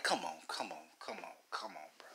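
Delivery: normal talking voice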